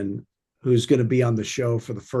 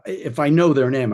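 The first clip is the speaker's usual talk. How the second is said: normal talking voice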